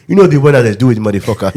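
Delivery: lightly put-on African accent; comic African accent. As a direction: imitate an African speaker. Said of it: "The way they say that".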